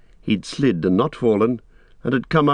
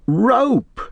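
very high, excited exclamation; high-pitched yelp of a word; Sam crying out; 14 semitones higher, 4 dB louder than usual. A narrator's reading. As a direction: cry out at a sudden realisation. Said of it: "cried Sam".

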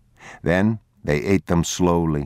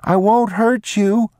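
put-on high, loud, slow child's call; boy calling gently; 5 dB louder, slower than usual. Boy reassuring a frightened stranger child, high and slow. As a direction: coax the hidden boy out, calling.

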